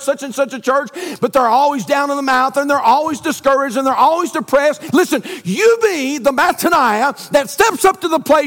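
loud, high preaching build; preaching at full force. Acted. Pastor urging the church to thankfulness, fervent preaching. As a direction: rouse the congregation from the pulpit.

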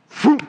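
quick, high whoosh; sudden high swoosh, like something snatched away; imitated gulping whoosh. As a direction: mimic a fish swallowing someone in one whoosh. Imitating the fish swallowing Jonah.

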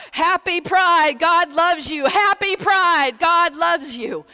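very high, loud, drawn-out shouted greeting; shouting to the crowd on both sides of the street; calling out. Retold or imitated. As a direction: call it out over and over across the street.

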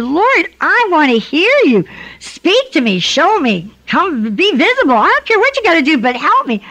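high, loud pleading prayer, pushing hard; desperate cry to God. Retold or imitated. A divorced wife cries out to the Lord, loud and pleading. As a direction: beg God out loud like you can't take another day. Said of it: "I just cried out and I said".

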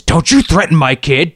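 angry retort. Retold or imitated. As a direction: throw it back like a threat. Angry dad confronting.

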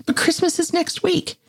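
put-on very high child's squeak; high, squeaky little voice; squeaky kid. A narrator's reading. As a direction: pipe up like a small kid. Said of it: "the kid squeaked".

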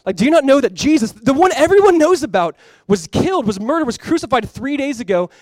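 high, loud disbelieving question, easing off; acted incredulity. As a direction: act astonished that he hasn't heard. Acts out disbelieving travelers questioning Jesus.

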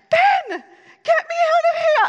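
high, fast, loud cry for help with a laugh; panicked shout. Retold or imitated. Wife stuck in the ceiling, shouting for husband. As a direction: yell for rescue, half laughing. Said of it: "I'm probably yelling too loud".

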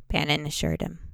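plain reading voice

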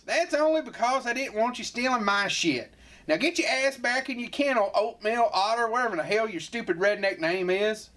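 high sneering scold. Acted. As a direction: tell the kid off up high. Skit sheriff scolding.

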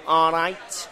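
nasal Mancunian hello; nasal Manchester accent. Shown as a demonstration. Pub owner teaching Manchester hello, nasal Manchester accent. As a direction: greet through the nose like a Manchester local. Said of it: "Manchester is very nasally".

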